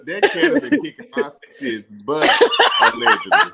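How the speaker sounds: giggling through a sly jab; laughing mock jab. Teasing a castmate, laughing. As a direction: crack up on allegedly. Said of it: "I was like".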